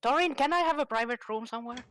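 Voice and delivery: usual speaking voice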